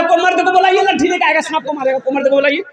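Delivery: high, hammed-up frightened call; mock the young man crying like the women; mock panicky women's cry. The timid young man calls for a man along with the women, hammed-up high panic. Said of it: "ये भी बोल रहा".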